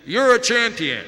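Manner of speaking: high, loud call thrown back; echoing answer. Acted. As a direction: ring it out like a mountain echo. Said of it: "the voice answers".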